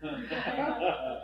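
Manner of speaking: breathy amused chuckle; chuckling at her own story; soft laugh. Real, not acted. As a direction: laugh quietly at herself. Laughs at the punchline.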